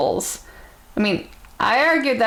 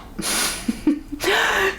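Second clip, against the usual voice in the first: a real, much higher laugh that fades out; 11 semitones higher than usual. Real, not acted. After reading the insult aloud, a real high laugh.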